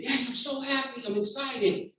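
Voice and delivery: lightly acted childlike excitement; excited child. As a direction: voice an excited kid talking to Daddy. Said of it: "as she says she's excited".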